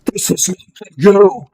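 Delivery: big, loud, high-pitched boom. Acted. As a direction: boom it like a voice from nowhere. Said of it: "as you hear a booming voice".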